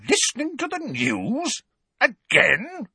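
very high, incredulous echo; outraged disbelief. A narrator's reading. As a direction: repeat it as an outraged question. Outraged relatives repeat his answer.

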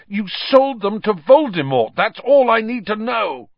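loud, high-pitched angry accusation; furious. A narrator's reading. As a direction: cut him off with an outburst of rage. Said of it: "a furious pounding in his ears".

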